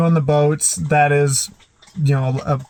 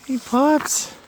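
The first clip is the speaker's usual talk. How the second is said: much higher cooing hello; high pet-talk greeting; 12 semitones higher than usual. Real, not acted. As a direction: sing it up to the pups. Greeting the dogs.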